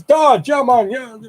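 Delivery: put-on high-pitched Jamaican accent; Jamaican Rasta accent. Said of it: "went right back into it".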